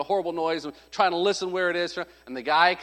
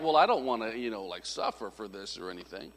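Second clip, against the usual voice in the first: low, fading reluctant mumble; backing down; 9 semitones lower, 7 dB quieter than usual. Acted. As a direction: shrink away, letting the voice drop to almost nothing. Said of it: "Peter's pride was like".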